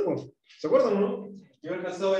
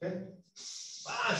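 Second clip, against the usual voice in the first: normal talking voice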